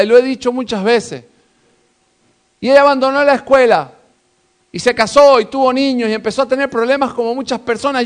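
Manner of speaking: high, loud fiery sermon voice; full-force preaching. Acted. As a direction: preach it hard to the congregation.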